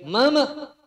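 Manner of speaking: high, very loud yell for his wife; husband screams for his wife. Acted. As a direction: yell across the house at her. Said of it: "Suaminya teriak".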